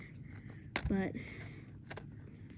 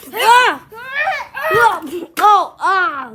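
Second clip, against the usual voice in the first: startled screams; 9 semitones higher, 11 dB louder, much slower than usual. Real, not acted. Attacked in game, screaming.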